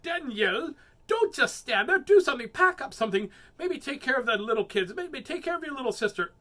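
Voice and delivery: loud, high nagging mom; bossy mother. Acted. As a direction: scold her into moving. Mother ordering child in story.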